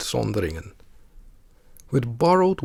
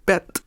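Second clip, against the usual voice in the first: "bed" stressed and higher, with short E; 6 semitones higher than usual. Shown as a demonstration. Teacher isolates second part of the word, "bed" stressed. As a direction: give "bed" full stress, final D as T. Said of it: "is stressed".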